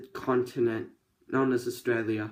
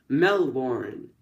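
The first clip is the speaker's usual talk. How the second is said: mock American accent; 4 dB louder than usual. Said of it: "I nailed the accent there".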